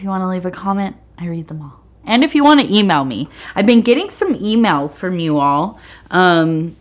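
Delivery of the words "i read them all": whispered aside; whispering. Real, not acted. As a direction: tuck it in as a whisper.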